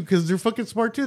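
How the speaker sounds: normal talking voice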